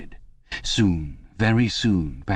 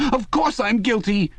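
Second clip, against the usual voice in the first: much higher, eager servile cry; 14 semitones higher than usual. Acted. Parsons insisting to the telescreen, high servile cry. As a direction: blurt it out, eager to please the telescreen. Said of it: "cried Parsons with a servile glance".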